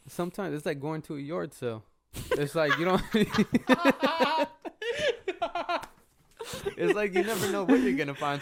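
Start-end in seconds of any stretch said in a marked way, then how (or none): 2.3-8.4 cracking up mid-sentence, high; laughing. Real, not acted. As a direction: talk through real laughter. Laughs while telling.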